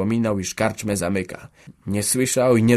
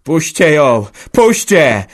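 loud, higher frantic yelling; 6 semitones higher, 9 dB louder, slower than usual. Acted. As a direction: yell out of your mind to let her go. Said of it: "bełkotał Antek zgoła nieprzytomnie".

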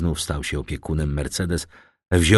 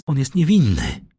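high-pitched protest; Mercedes, crying out; 7 semitones higher, 3 dB louder than usual. A narrator's reading. Mercedes defending Dantes to the shipowner, crying out. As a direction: protest his innocence in a raised voice. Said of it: "zakrzyknęła Mercedes".

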